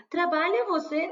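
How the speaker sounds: mock how a wrong learner talks; mock broken speech. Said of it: "falando que nem índio".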